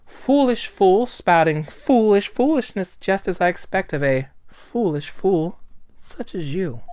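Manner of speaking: slow, scornful insult in a put-on voice; haughty prosecutor. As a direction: draw out each insult with theatrical disdain.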